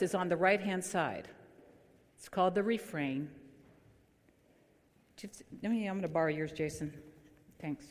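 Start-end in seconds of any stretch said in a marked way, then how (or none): none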